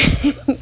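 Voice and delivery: short giggle held back; suppressed chuckle; stifled laugh. Real, not acted. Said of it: "I'm trying not to laugh".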